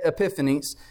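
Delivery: usual speaking voice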